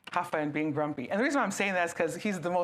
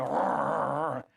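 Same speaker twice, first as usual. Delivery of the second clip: grumpy growl; 5 semitones lower than usual. Retold or imitated. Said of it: "he's gonna go".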